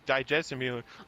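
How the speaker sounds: ordinary talk, nothing marked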